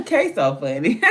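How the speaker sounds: bursts of high, loud laughter around the words; hollering laughing. Real, not acted. Streamer laughing hard at a chat message. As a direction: crack up for real. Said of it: "I am hollering".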